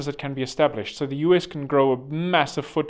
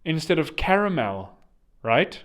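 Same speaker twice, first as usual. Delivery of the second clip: ordinary talk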